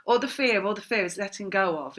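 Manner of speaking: normal talking voice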